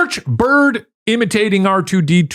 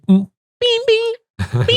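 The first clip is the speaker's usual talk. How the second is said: imitated chime. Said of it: "I started hearing".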